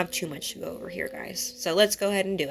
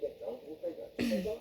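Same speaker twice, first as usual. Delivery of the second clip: throat clear; 11 dB quieter than usual. Real, not acted.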